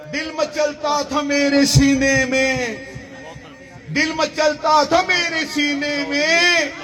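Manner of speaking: measured, projected mushaira recitation; poetry recital. Acted. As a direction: declaim the verse to the crowd, stretching the line ends. Recites Urdu poetry to audience.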